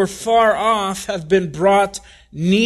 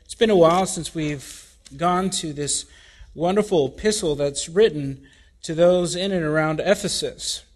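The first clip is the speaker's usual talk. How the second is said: ordinary delivery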